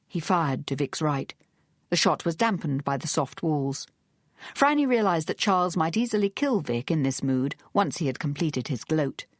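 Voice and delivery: ordinary delivery, nothing marked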